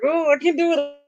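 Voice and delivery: high, panicky mock whimper; high, fading "I can't"; freaking out. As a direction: play the ostrich panicking. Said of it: "you know, I freak out".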